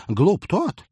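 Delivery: high, loud mock-pompous oration; pompous captain voice. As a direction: parody his grandiose pronouncement. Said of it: "попыталась скопировать пафосный голос капитана".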